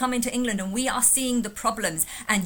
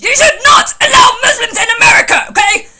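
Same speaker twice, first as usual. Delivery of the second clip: parody rant; 11 semitones higher, 15 dB louder, faster than usual. Retold or imitated.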